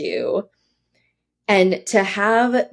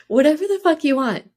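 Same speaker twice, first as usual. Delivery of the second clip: higher, playful echo of the dad's line; host echoing guest's dad, playful higher voice; 8 semitones higher than usual. Retold or imitated. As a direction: laughingly quote the dad back. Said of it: "everyone hear that?".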